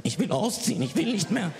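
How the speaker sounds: put-on half-hearted kid's sob; mock sobbing. Child sobbing alone in his room, mildly whiny. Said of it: "ich heul mich zu Tode".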